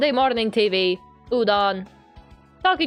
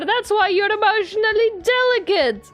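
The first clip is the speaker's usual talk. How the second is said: playful scolding; 11 semitones higher than usual. Acted. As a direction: chide him brightly, much higher. Heroine scolds teacher playfully.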